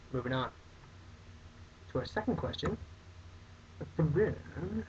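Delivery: ordinary talk, nothing marked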